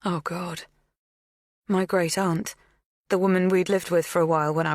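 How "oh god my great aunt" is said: plain reading voice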